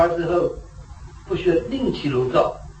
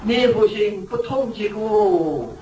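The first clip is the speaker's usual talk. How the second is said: 抬高音调、一字一顿地念出; 像宣读经句般郑重放慢; 缓慢引述古语; 7 semitones higher, much slower than usual. Retold or imitated. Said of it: "下面一句话就是". (提高音调缓慢诵读古语)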